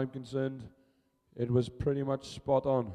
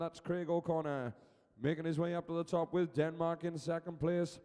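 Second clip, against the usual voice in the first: sustained, raised play-by-play voice; live race commentary; 3 semitones higher, 7 dB quieter than usual. Real, not acted. Commentator calling the relay race for spectators, live play-by-play. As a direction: call the race as it unfolds.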